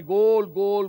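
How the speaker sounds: ઉત્સાહમાં જોરદાર બૂમ, પણ નકલી; મેદાનમાં બૂમ મારતો હોય એમ; ગોલની બૂમ. Retold or imitated. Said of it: "બૂમ પાડી". (મેચ જોતો પ્રેક્ષક ચોગ્ગા પર ગોલ ગોલ બૂમ પાડે)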